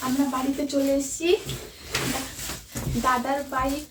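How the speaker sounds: hushed voice. Real, not acted. Secret birthday prep, hushed so sister can't hear. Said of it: "আস্তে আস্তে কথা বলতে হবে".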